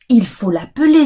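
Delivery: ordinary talk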